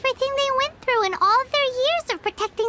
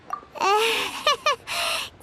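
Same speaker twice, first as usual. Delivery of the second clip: short bright giggle; playful laugh. Real, not acted. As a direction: laugh at your own flourish. After dramatic declaration.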